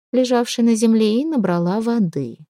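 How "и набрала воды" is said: plain reading voice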